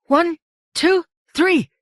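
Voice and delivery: high called count for a heave. A narrator's reading. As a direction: call the count to lift together. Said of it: "Candy counted".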